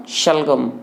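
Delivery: शलगम with the first sound श made at the palate; palatal SH demo. Shown as a demonstration. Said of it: "फिर इसका उच्चारण होगा". (शलगम में तालव्य श का उच्चारण)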